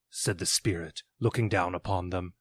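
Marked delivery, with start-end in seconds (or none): none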